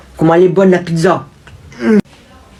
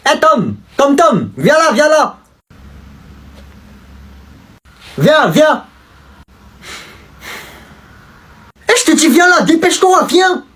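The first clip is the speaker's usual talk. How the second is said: calling out; 8 semitones higher than usual. Acted. Calling Tom out of school.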